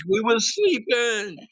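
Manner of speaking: high, stretched-out mock whine; complaining neighbors. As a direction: imitate woken neighbors calling across. Acting woken neighbors calling out.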